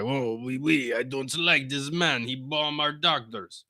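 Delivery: badly faked French accent, drawn out; terrible French accent. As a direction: put on a cartoonish French accent. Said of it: "It's a terrible French accent".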